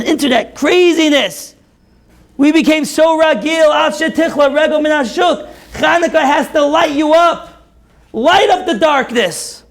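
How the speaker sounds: high, intense rising sermon climax; fiery preaching. Acted. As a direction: preach it at full force, building. Sermon climax at full force.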